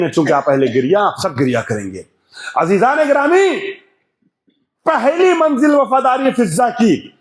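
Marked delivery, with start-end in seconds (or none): 2.6-7.1 high, ringing, drawn-out announcement; impassioned majlis preaching. Acted. Preacher announcing Fiza's first stage of loyalty, impassioned. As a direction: call out the first stage to the hall.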